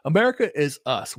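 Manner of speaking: normal talking voice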